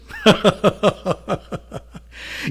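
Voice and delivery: unhinged giggle of a killer; mad laugh. Acted. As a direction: laugh like a man coming apart. Narrator's mad cackle.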